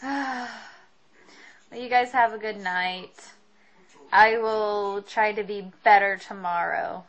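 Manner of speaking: ordinary talk, nothing marked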